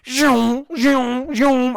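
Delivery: high-pitched car zoom noises; imitated zooming cars. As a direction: zip out rising engine sounds, one after another. Imitates cars racing past.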